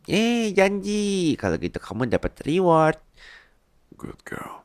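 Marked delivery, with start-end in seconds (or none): 0.1-1.3 high, slow, stretched-out delight; long high gleeful drawl; drawn-out delight. Acted. 4.0-4.6 breathy whispered praise, softer; hushed reward whisper. Acted.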